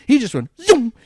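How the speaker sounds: high, loud zoom of a bike flying past; excited zoom. Retold or imitated. Recalling the speeding biker to friends, a high loud zoom. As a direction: fling the word out like a speeding motorcycle.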